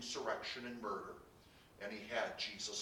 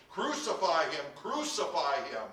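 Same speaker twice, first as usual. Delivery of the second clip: loud repeated chant of a mob; shouting; 5 semitones higher, 6 dB louder than usual. A narrator's reading. Crowd keeps demanding execution from Pilate, shouting. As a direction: shout each demand hard. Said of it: "they shouted".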